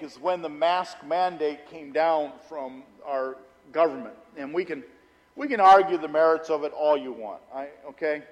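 ordinary delivery

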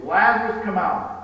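loud, commanding call to the tomb. A narrator's reading. Jesus calling Lazarus from the tomb, loud command. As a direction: call out to the dead man with full voice. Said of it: "Jesus called in a loud voice".

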